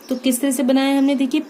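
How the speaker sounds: ordinary talk, nothing marked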